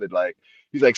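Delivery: ordinary talk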